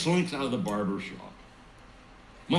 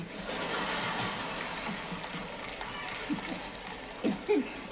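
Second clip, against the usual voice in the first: breathy laugh; 7 dB quieter than usual. Real, not acted. Laughs at own joke.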